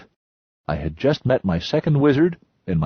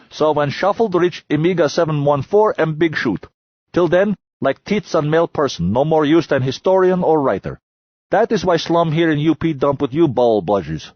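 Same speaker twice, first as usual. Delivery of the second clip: put-on broken English in a higher Lunar miner accent; 7 semitones higher, 5 dB louder than usual. A narrator's reading. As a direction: boast in a clipped foreign Lunar accent. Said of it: "He pronounced it like shuffled".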